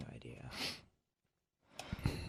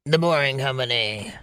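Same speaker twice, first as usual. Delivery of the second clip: put-on company voice. Co-host plays along on the company name, put-on voice. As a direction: say the name in a mock character voice. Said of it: "You gotta say it in that voice".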